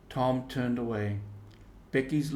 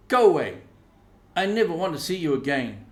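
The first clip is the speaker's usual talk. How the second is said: loud, fast higher brush-off; angry tearful girl; 7 semitones higher, 10 dB louder, faster than usual. Acted. Becky to Alfred, telling him to go away, loud and upset.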